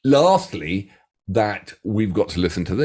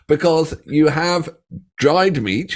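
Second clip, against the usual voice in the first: ordinary talk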